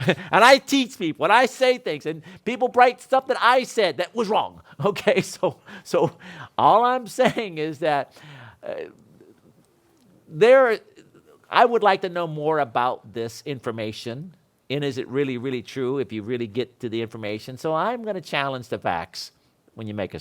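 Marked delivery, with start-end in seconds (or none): none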